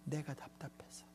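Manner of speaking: breathy whispered aside. Acted. Pastor whispers to the congregation, then a hushed aside. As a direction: whisper it like a confession.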